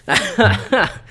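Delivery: short, high self-mocking chuckle; laugh. Real, not acted. Host bitching about weather two weeks running, laughing. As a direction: laugh at your own griping. Laughs at his own griping.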